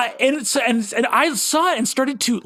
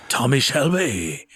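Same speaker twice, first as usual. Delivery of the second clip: low, slow Birmingham growl of a name; Tommy Shelby impression; 6 semitones lower, slower than usual. Quoting Pacino to Cesar Romero, low Birmingham accent. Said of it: "impression was".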